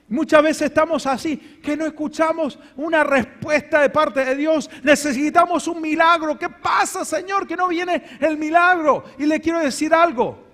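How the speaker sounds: fervent pulpit voice. Acted. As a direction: preach it to the congregation. Preaching at full force.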